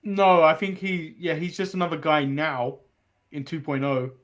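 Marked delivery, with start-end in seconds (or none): none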